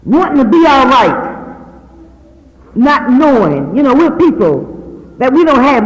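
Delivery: a hint of a slow, swelling preacher's build; lightly preached appeal to the church; preaching. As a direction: lean into it and preach it. Preaching testimony to congregation.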